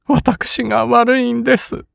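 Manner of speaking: high voice, sobbing through the words. A narrator's reading. Kin blames herself to her brother, sobbing. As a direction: choke out the apology between sobs. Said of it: "金はむせび泣きながら言った".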